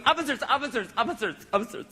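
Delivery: lightly imitated urgent appeal; acted pleading wife.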